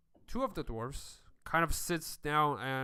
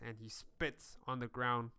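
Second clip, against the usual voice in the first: ordinary delivery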